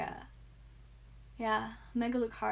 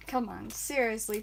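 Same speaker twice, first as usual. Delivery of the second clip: ordinary talk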